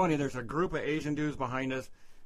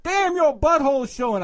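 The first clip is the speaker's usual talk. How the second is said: high, very loud heckle; retold loud yell; 12 semitones higher, 10 dB louder than usual. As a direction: yell it really loud across a crowd. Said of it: "I yelled it really loud".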